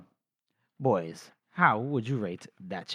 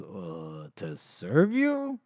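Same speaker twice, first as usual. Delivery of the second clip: incredulous question; 6 semitones lower, much slower than usual. Retold or imitated. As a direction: stretch it out in mock disbelief. Glokta incredulous at Baez's demand.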